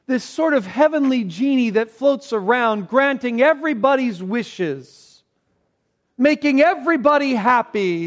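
high, loud, driving sermon delivery; fiery preaching. Acted. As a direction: preach it hard to the congregation. Preaching at full force.

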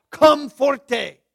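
loud Latin-style accent on comforte; foreign-accented "comforte". Shown as a demonstration. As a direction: punch out "comfor-TAY" with a foreign flourish. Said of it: "I like that accent".

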